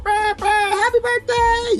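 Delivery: high, slow mock honk and birthday cheer; a partygoer calling from a car, hammed-up high and slow; mock drive-by yell. Mocking drive-by revelers, a high, drawn-out hammed-up cheer. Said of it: "yelling out the window".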